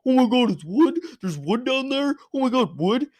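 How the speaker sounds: high, loud mock thrill over wood; mock excitement. Mocking the show's diggers, squealing over wood. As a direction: ham up a gasp of wonder. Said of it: "how they just find".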